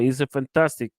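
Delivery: ordinary delivery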